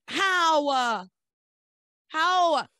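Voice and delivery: drawn-out, high and loud challenge; loud disbelief. Real, not acted. Host losing patience with Ivy's excuse, loud disbelief. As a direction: burst out in real disbelief.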